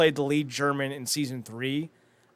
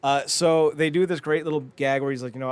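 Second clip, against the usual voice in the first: usual speaking voice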